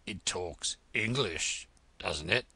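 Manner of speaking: low, lazy frog drawl; slow frog drawl. Acted. Frog answers Alice, low slow drawl. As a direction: drag it out like a dull old frog.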